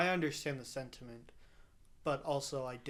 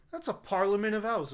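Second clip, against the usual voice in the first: put-on higher, louder exclamation in own accent; raised, loud voice, no British accent; some guy exclaiming; 7 semitones higher, 8 dB louder than usual. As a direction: announce it like a guy coining a name. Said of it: "so some guy was like".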